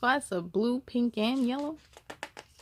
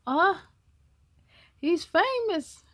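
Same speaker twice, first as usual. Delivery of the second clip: plain reading voice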